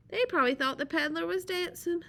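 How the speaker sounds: plain reading voice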